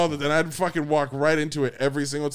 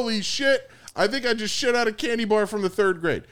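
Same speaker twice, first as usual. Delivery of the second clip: high-pitched mock astonishment; retold shock; 7 semitones higher than usual. Telling friends about a violent bathroom visit, mock shocked. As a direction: act out stunned disbelief, pitched up. Retelling his shocked reaction.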